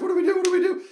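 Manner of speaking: high, loud panicked question; loud high panicky repeat. Retold or imitated. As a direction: fret out loud. Panicked friends on lift.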